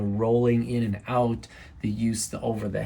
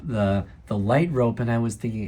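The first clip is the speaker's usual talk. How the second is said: normal talking voice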